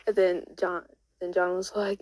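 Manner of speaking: ordinary delivery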